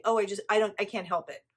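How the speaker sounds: loud, fast, mock-helpless whining; whiny excuse-maker. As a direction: parody someone dodging blame. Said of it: "people can't just say".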